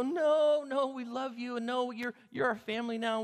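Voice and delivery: high, insistent pleading, loud on the first no; pleading protest. Acted. Daughters-in-law refusing to leave their mother-in-law, pleading.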